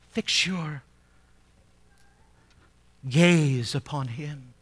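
ordinary delivery

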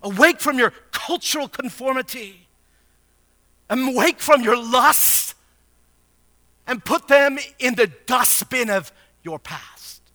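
high-pitched pulpit exhortation; full-force preaching. Acted. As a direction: urge it on them phrase by phrase, with long pauses.

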